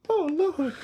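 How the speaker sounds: high laughing cry. Real, not acted. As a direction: squeal it through laughter. Laughing exclamation.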